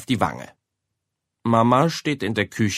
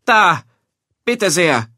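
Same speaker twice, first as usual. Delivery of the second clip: loud, higher accusing call; angry mother; 8 semitones higher, 6 dB louder, slower than usual. A narrator's reading. Mother showing her son the ruined decoration, angry. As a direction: thrust it under his nose. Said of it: "ruft Mama".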